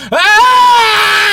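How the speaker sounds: sudden loud high yelp; cry out. Retold or imitated. Retelling his racing mind, a loud high yelp. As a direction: blurt out a loud anguished "Ah!".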